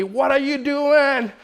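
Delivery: high, loud, drawn-out yell; alarmed yell. Retold or imitated. Bystander yelling at father with baby at window, alarmed shout. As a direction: yell up at someone in alarm, imitated. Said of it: "somebody's yelling at me".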